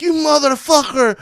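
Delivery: high, loud angry yell; imitated yell. Fighter yelling while coming at him, high and loud. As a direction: throw it like he's charging at you. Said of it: "he was like".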